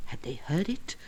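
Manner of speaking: breathless whisper, barely voiced; tense whisper. A narrator's reading. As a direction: whisper it as if afraid of being overheard. Tense moment, listening for hoofbeats.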